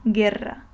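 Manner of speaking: "guerra." joined up with hard G, silent U; normal hard G. Shown as a demonstration. Teacher putting the word together, "guerra." with hard G, silent U. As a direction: say "guerra." as geh-rra in one piece. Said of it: "you just read the G in its normal form".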